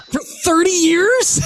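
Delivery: high, drawn-out disbelief; laughing through astonished disbelief; amazed. Real, not acted. Marvelling to a friend about the kept rock, amazed.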